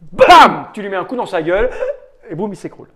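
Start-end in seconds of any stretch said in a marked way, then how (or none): 0.1-0.7 punchy loud sound-effect word; loud exclamation. Acted. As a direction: bark out the hit. Game master narrates a punch.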